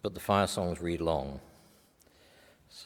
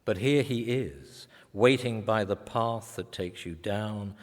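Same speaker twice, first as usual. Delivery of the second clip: poetry reading; 3 dB louder than usual. Acted. Poet reading his verse story of a woman on the edge, hushed and probing. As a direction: press each question gently toward the woman.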